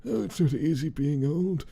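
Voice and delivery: high, put-on old-woman lament; old lady. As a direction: sigh it out like a little old lady. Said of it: "It's me hanging out with".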